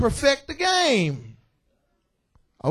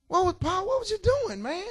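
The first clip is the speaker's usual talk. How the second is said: much higher, teasing disbelief; incredulous teasing; 9 semitones higher than usual. Pretend. Preacher ribbing Paul about jail, incredulous and much higher. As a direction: ask it like catching a friend red-handed.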